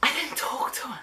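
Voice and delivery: unvoiced, disbelieving whisper. Real, not acted. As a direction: breathe it in disbelief. Stunned aside.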